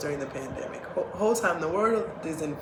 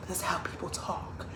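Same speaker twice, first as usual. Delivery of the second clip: lightly imitated classical speaker, kept quiet; grandmother's proper speech; 9 dB quieter than usual. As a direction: softly mimic a very proper grandmother. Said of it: "she still talks like this".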